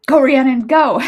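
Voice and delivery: loud cheerleader shout; cheer. Pretend. Cheerleader cheering her name, loud shout. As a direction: cheer from the sidelines.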